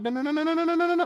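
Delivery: desperate denial, climbing in pitch; real panic as the vod vanishes; panicked no. Real, not acted.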